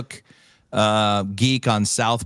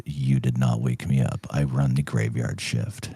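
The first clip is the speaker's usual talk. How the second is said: much lower, gruff grumbling voice; a deep grumpy technician; grumpy tech; 10 semitones lower, 5 dB quieter than usual. Retold or imitated. The grumpy tech snaps at the gambler, low grumble.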